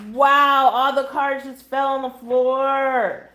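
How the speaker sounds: real surprise. Real, not acted. Reader to viewers as cards fall, startled and loud. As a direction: caught off guard as the deck spills. Tarot cards spill onto the floor.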